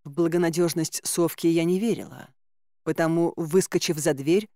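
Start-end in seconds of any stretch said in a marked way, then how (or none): none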